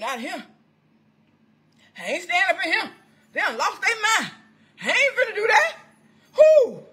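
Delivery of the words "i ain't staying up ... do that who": acted refusal. Acts out refusing to stay.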